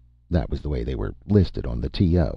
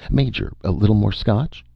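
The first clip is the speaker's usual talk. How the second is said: higher, pressing cried offer; crying out; 7 semitones higher, 3 dB louder than usual. A narrator's reading. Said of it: "Vern cried".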